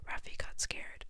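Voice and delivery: plain reading voice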